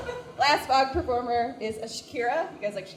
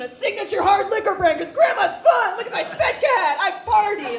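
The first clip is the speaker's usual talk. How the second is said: higher, louder sarcastic whoop; mock party grandma; 9 semitones higher, 10 dB louder than usual. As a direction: shout it like a partying grandma, mocking. Mocking mom's party vibe.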